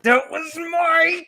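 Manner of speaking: high, loud, drawn-out mock indignation; hammed-up offended rival; Cory Booker. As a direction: stretch it out in an outraged high voice. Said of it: "Cory Booker just is like, looking at".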